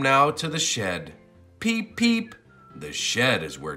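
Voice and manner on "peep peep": ordinary delivery, nothing marked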